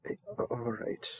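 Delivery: breathy whisper under the breath; whispered aside. Acted. New servant whispering to himself outside the bath, flustered whisper. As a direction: whisper it so the sovereign can't hear.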